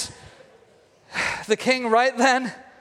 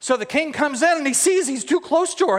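ordinary delivery

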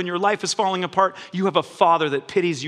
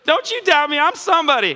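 mock proud believer; 6 semitones higher than usual. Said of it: "voicing a proud believer".

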